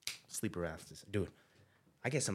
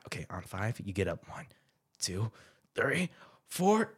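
ordinary talk, nothing marked

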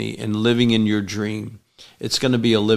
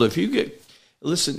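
usual speaking voice

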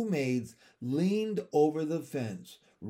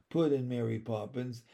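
usual speaking voice

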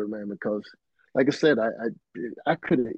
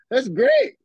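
high, loud disbelieving insistence; incredulous plea; 6 dB louder than usual. Retold or imitated. Pleading with Prince about Greg, high incredulous. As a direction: squeeze it out in disbelief.

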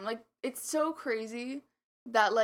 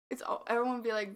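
normal talking voice

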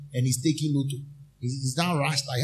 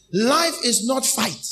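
higher, louder, slower push; raised emphatic; 7 semitones higher, 4 dB louder, slower than usual. Acted. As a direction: punch it home to the crowd. Preacher emphatic correction.

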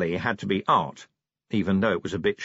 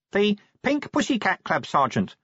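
dutiful, higher-pitched answer to a superior; obedient reply; 11 semitones higher than usual. A narrator's reading. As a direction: report it meekly. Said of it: "said Nobby obediently".

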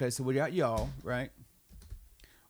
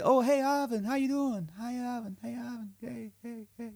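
high-pitched, fading chorus of hellos; mock greeting; 9 semitones higher than usual. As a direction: imitate nosy greeters, voice trailing away. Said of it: "They don't say".

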